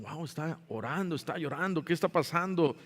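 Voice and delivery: soft murmur of wonder; hushed onlookers. Acted. Onlookers in the patio notice Esdras praying, hushed murmur.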